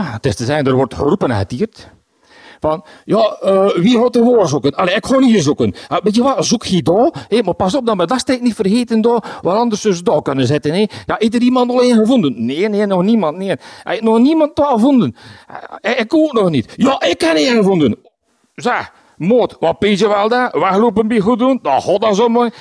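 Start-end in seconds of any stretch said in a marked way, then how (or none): 2.6-10.9 lively overlapping calls, high; excited shouting. Retold or imitated. Neighbours shouting during gnome search. 11.1-21.1 high, fast eager calls; excited calling out. Retold or imitated. Searchers calling out across gardens.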